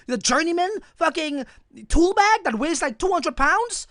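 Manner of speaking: high, loud, furious complaining; loud indignant venting about the journeyman's tool bag; retold angry rant. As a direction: blow up at the boss all over again.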